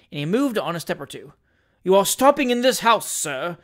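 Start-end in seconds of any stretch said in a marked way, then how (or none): 1.8-3.6 puffed-up outrage; indignant. Acted. Little doctor challenging stranger, indignant. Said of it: "said the indignant little man".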